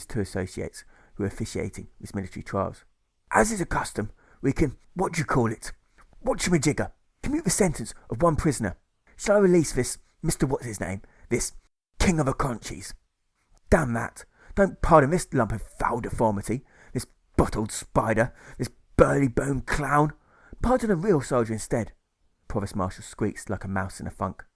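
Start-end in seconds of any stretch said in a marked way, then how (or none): 3.3-12.9 pompous colonel, pitched up; a lightly raised blustering officer voice. Acted. Colonel proposes pardon. 13.7-21.9 scornful objection. Acted. Officer objects with contempt.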